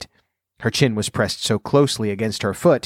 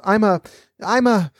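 flustered; 10 semitones higher than usual. A narrator's reading. Alice flustered.

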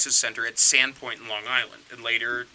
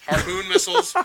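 Harpoon missiles jumps much higher in disbelief; amazed reveal; 4 dB louder than usual. Real, not acted. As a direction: sell it as a shocking reveal. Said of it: "No, I shit you not".

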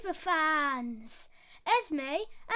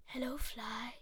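sly spider; 7 semitones lower, 10 dB quieter than usual. Acted. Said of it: "quite a sly one for Spider".

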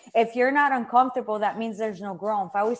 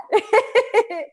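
hearty laugh; 10 semitones higher, 6 dB louder than usual. Pretend. Channeling the laughing grandmother.

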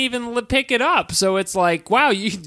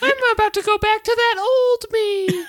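high squeaky elf voice, laughing; tiny tough bug, giggling through it; little elf voice. Retold or imitated. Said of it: "a little elf voice".

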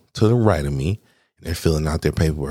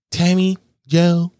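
high, drawn-out name call; high-pitched imitated roll call; mock calling out; 13 semitones higher, 4 dB louder, slower than usual. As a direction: call the names out high and slow. Names of clients called out.

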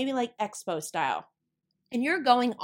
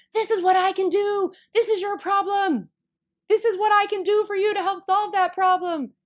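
higher, louder pitch shouted across a noisy room; yelling to the crowd; 8 semitones higher, 6 dB louder than usual. Retold or imitated. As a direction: bellow your pitch at the whole expo hall. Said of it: "you just start yelling".

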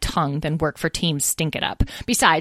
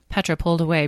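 ordinary delivery, nothing marked

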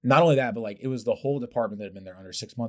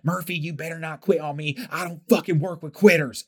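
put-on higher, pushy Mississippi accent; 8 semitones higher, 5 dB louder than usual. Sam in Mississippi accent pushing Murphy not to quit. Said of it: "with his Mississippi accent".

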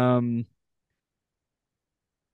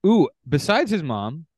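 normal talking voice

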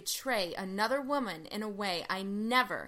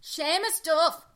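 put-on Irish accent; 4 semitones higher than usual. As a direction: tease him in a mock Irish lilt. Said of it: "do the whole podcast in an Irish accent".

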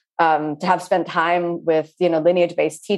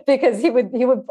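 ordinary talk, nothing marked

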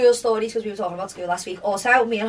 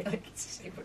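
whispering; 16 dB quieter than usual. Retold or imitated. Quiet aside to friend.

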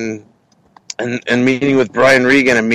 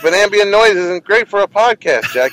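higher-pitched, mock-worried objection; retold protest; 7 semitones higher than usual. Podcaster objecting to comic about background noise, retold protest. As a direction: push back in a raised, retold voice. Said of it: "I'm like".

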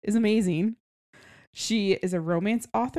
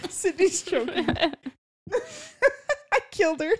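squealing with laughter; laughing hard; 11 semitones higher, slower than usual. Real, not acted. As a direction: talk through a giggle fit. Laughing fit after joke.

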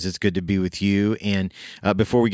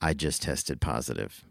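hushed and grave, much softer; quiet, somber; 4 semitones lower, 9 dB quieter than usual. Retold or imitated. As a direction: break bad news softly. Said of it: "she's like".

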